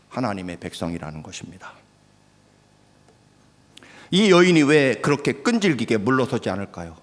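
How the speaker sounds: ordinary talk, nothing marked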